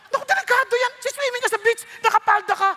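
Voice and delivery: high, excited mock warning; preacher warning the congregation, high and lively; comic alarm. Real, not acted. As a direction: play up the danger for laughs. Comic alarm about skirts at beach.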